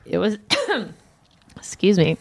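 coughing, voice catching; talking through a choke; choking. Real, not acted. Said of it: "Sorry, I'm choking to death".

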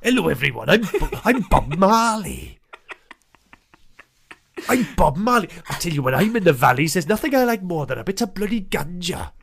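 a Marley that drifts into the valleys; [name] as Welsh. Acted. Cohost doing [name] that sounds Welsh, mock accent. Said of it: "can you do Welsh".

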